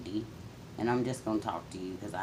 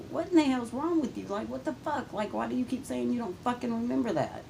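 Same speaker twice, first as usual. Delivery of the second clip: much higher, incredulous outburst at mother; exasperated; 12 semitones higher than usual. Retold or imitated. Daughter venting at her mother, high incredulous outrage. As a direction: throw the question at her in disbelief. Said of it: "I used to wonder".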